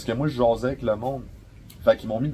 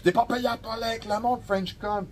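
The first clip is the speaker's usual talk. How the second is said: lightly voiced bossy young manager, higher; a hint of a snide boss; imitating the manager's put-down, pitched higher; mocking the manager; 11 semitones higher than usual. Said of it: "il m'a donné de la merde".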